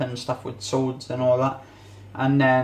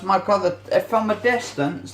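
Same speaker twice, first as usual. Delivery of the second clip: usual speaking voice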